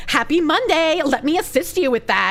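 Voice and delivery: put-on melodramatic Valley girl with swooping pitch; hammed-up, traumatized Valley girl. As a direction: play a Valley girl recounting a horror story. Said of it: "replicate Kirsch's Valley Girl voice".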